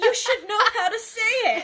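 high, loud mock scolding; mock indignant. Joking about a Ninja Turtle name, mock scolding. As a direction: scold it in jest.

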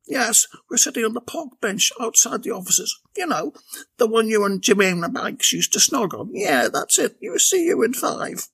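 breezy call to her brother; Bella on the phone. A narrator's reading. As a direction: Bella arranging a lift on her cell.

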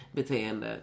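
ordinary delivery

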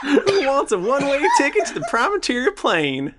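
put-on high, cheery old priest; hammy welcoming cleric, breaking into laughs; priest character voice. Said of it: "Father Tierney comes in and he goes".

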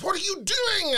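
high, exasperated mock cry; exasperated producer. The London producer rings her mid-show, high exasperated cry. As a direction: squeal it in disbelief at the booker. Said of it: "the guy from London went".

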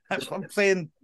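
normal talking voice

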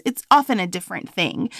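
ordinary talk

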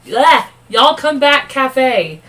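"Y'all Come Back Cafe" slow, high and loud with delight; delighted slow drawn-out read; excited. Real, not acted. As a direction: gush over the cute name. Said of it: "I got too excited".

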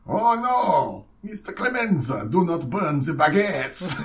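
high, alarmed mock French; French accent. French mobster begging to save the baguettes, high mock French. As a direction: plead in a hammed-up French accent. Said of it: "it's the French mafia".

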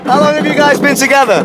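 loud, high shouting over roller coaster noise; shouting over the ride. Real, not acted. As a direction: yell every word over the wind. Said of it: "You can hear me screaming for my life".

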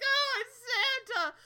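high, slow relieved cry; joyfully spot rescue coming; excited cry. Retold or imitated. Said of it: "They're like".

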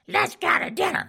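high squeaky old wife, mock sweet; falsetto wife voice. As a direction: play the wife in a shrill falsetto. Wife voiced in retold story.

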